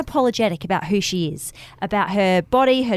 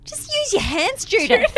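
mock scolding; 13 semitones higher than usual. Teasingly scolding the character.

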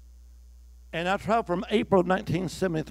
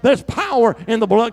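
there's power in the blood cried out higher and louder; loud preached; 8 semitones higher, 11 dB louder than usual. Shown as a demonstration. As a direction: call it out for the congregation to repeat. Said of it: "Come on, say that".

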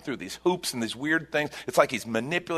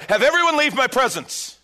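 high, loud commanding cry; a ruler's sudden loud order; cried out; 13 semitones higher, 6 dB louder than usual. A narrator's reading. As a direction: cry out to clear the room. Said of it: "He cried out".

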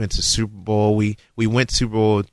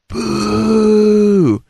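long high loud boo like a stadium crowd; sustained loud jeering boo; 12 semitones higher, 8 dB louder than usual. Retold or imitated. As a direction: imitate the fans booing. Crowd booing imitated.

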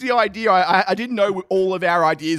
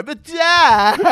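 put-on higher whine of a teenager to his father; whiny kid; 7 semitones higher, 7 dB louder than usual. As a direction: son whining to his dad about running. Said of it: "It's only three weeks ago".

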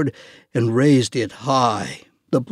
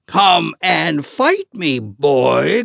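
slow, booming taunt; giant shout; 3 semitones higher, 4 dB louder, slower than usual. Acted. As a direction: bellow the challenge. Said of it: "Goliath shouted".

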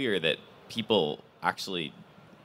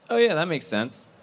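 higher, louder, matter-of-fact mock stranger; casual stranger; 10 semitones higher, 4 dB louder than usual. A visitor shrugging it off to the speaker, offhand and higher. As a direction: lightly voice a stranger who finds it obvious. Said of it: "a person would just say".

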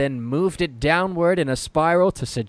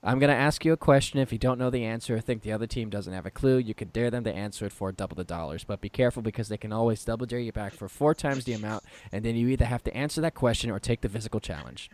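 game show rules read; 5 semitones lower, 9 dB quieter than usual. Shown as a demonstration. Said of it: "I say the rules of Double Dare".

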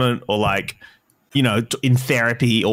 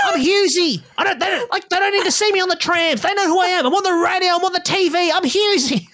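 unhinged, very high-pitched mock rant; indignant comic insisting everyone knows him; Dave Hughes. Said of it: "just this unhinged rant".

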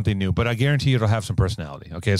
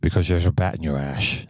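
lower, sloshy British butler voice; hammed-up Alfred punchline in a lower voice; Alfred accent; 6 semitones lower than usual. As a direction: deliver the punchline as a wet-mouthed butler. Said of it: "You got the sloshing sound".

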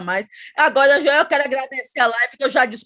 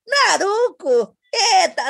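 drawn-out, higher, proud Naruco with a laugh; proud show-off; 7 semitones higher, 3 dB louder, much slower than usual. Retold or imitated. Mother retelling how she proudly said the wrong name, mock-proud. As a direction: stretch the word Naruco like you are showing off. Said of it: "eu dizia".

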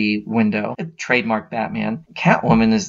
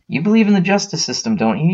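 higher-pitched pointed challenge; acted confrontation; 7 semitones higher, faster than usual. Harvey corners Vernon in his office, confronting him. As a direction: press the question with a raised voice.

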